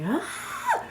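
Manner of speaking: very high, loud mock scream; sudden high shriek, replaying a freakout; acted-out scream. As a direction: let out a piercing panicked "Ah!". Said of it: "and I get to screaming".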